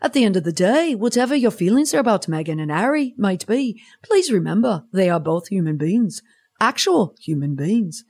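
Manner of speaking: mock Liverpool accent; Liverpudlian accent. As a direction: have a go at a singsong Scouse accent. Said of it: "maybe I should have done it in a Liverpoolian accent".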